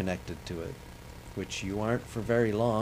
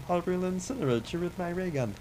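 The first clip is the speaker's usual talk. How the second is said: much higher cartoon alien voice; Marvin the Martian; 9 semitones higher than usual. Gamer shows his one cartoon voice, high Martian squeak. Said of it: "Marvin the, uh, you know, the alien".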